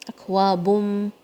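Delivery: اکوابم slow with the noon merged into meem; full idgham. Shown as a demonstration. Teacher drills the merged اکوابم slowly. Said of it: "پورا complete ادغام ہوگا".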